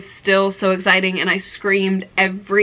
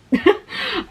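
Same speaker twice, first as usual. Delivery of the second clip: short high-pitched giggle; a quick squeaky laugh of delight; 13 semitones higher than usual. Real, not acted. Fan giggling at [name]'s crossed-out birthday card.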